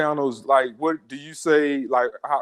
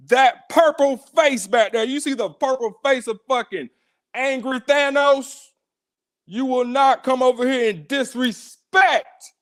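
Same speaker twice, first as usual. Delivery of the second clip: shouting; 6 semitones higher than usual. Real, not acted. Host shouting at caller.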